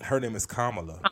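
soft, low correction: "Kamala" as KA-ma-la; stress on first syllable. Shown as a demonstration. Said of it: "accent's on the first syllable".

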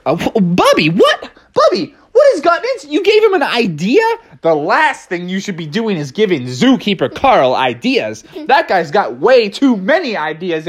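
high, fast, alarmed disbelief; shocked friends. Acted. Animals shocked that Bubby helped Carl, high and alarmed. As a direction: sputter in alarm.

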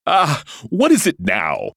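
loud, high, drawn-out excitement; eager cry. A narrator's reading. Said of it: "the unicorn cried eagerly".

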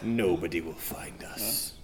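despairing whisper. Acted. Castaway despairs.

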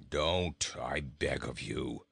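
low, glum goat plea; deep, dreading goat; begging goat. A narrator's reading. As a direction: beg wearily in a deep voice. Goat dreads another verse.

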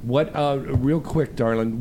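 ordinary delivery

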